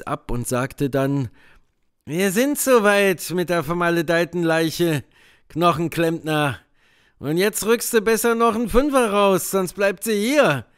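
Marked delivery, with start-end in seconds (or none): 2.1-10.7 gruff demanding man. A narrator's reading. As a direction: demand more money. Potter's voice in dialogue.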